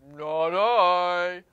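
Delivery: mild low cow refusal. Acted. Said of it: "The cow says in the low voice".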